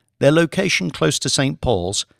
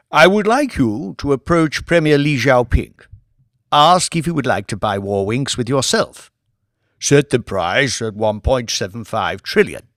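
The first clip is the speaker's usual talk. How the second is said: a louder, commanding lordly voice; Lord Martin; 4 dB louder than usual. Acted. Lord briefing his bankers on the Warwink bid, commanding. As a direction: run the meeting like a peer who owns the room.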